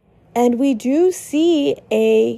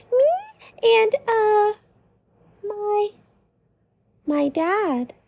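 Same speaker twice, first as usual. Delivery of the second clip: hesitant Lancer; 8 semitones higher than usual. Acted. Lancer admitting his dad lives there, fading. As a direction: falter and fade on my dad.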